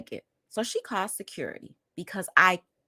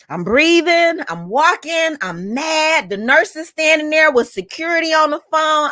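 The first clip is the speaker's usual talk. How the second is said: high, loud, worked-up retelling; agitated anger; 10 semitones higher, 9 dB louder than usual. Retold or imitated. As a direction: fire off each phrase at full agitation. Re-enacts being mad, pacing.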